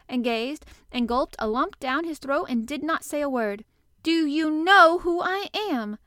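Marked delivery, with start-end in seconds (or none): 4.0-6.0 high, very loud, slow, drawn-out demand; more imperious demand. A narrator's reading. Colin demanding an answer from the staring gardener, very imperious. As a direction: press every word imperiously. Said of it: "still more imperiously".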